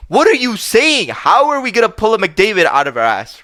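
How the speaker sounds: incredulous. Retold or imitated. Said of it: "I didn't obviously say that".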